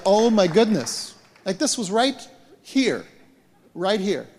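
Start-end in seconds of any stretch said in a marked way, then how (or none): 0.1-1.1 a slow, awed gasp of wonder, higher and louder; amazed exclamation. Retold or imitated. Preacher retelling a healing to the congregation, awed exclamation. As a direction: let the amazement spill out slowly. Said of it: "And it was like".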